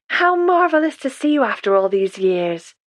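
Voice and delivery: plain reading voice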